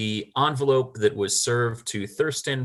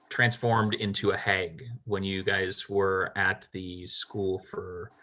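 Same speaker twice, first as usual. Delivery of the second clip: ordinary talk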